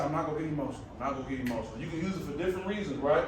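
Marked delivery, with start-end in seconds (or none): 0.0-1.8 low, quiet note to self; calmly steady yourself under your breath; soft, low repeated self-reminder; positive self-talk. Retold or imitated. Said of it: "I just use positive self-talk".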